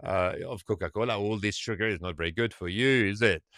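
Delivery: low, soft, quick words of caution; cautioning friend. Retold or imitated. Friend warning the Italian about sugar, low-key caution. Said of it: "is saying to his friend".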